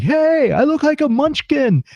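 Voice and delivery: high, stretched-out cartoonish call; Benito. Friend on the phone about his orange skin, high mock cry. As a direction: imitate a friend in a high, drawn-out voice. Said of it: "I called Benito and he was like".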